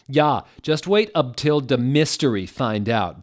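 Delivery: mild mock yell. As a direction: shout back. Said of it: "Vampire yelled".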